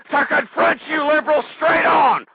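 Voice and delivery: angry shout to the liberals. Real, not acted. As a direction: confront them head on, full volume. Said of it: "that's why I have a damn torn voice box".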